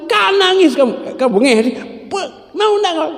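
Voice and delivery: playful mock scold; mock scolding parent. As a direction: tease the crying child in a high voice. Scolding the crying child.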